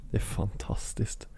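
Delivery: whispered in delighted awe. Real, not acted. Friend marveling at how alike Lars and the dad are, whispered. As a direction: breathe it out amazed. Awed about the lookalike.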